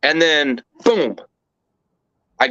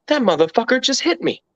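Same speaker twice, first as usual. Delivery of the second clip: raised pitch, disbelieving indignation; stunned outrage; 8 semitones higher than usual. Retold or imitated. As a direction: spit it out like you can't believe he hit you. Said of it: "all I can think is".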